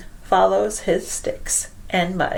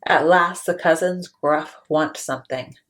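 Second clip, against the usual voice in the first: plain reading voice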